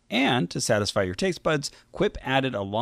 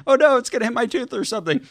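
imitating worried wife; 12 semitones higher, faster than usual. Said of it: "she was, like, really worried".

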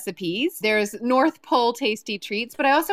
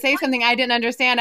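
ordinary talk, nothing marked

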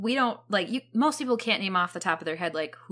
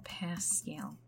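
whispered; 6 semitones lower, 15 dB quieter than usual. Real, not acted. Whispered name in awe.

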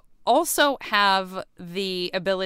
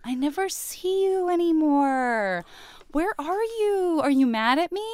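put-on whiny girlfriend; 9 semitones higher than usual. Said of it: "Jessica Alba is gonna be like".

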